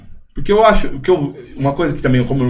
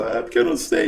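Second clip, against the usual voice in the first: put-on much higher, softer self-pitying kid; self-pitying whine; 14 semitones higher, 6 dB quieter than usual. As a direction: whine like a victimized child. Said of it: "cheguei me vitimizando".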